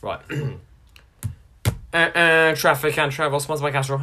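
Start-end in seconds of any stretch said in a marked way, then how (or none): 1.9-4.0 loud announcer jingle. Acted. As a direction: boom it like a radio ident.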